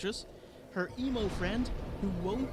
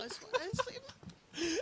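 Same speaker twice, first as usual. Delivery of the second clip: ordinary talk, nothing marked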